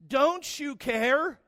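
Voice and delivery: high and very slow, stretched out; disbelieving kid. Retold or imitated. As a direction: drag out the question like a stunned boy. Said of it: "looking at her like".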